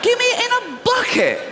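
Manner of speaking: high, squealing demand for a bucket; mock greedy customer. Fat customer ordering meal, high whiny demand.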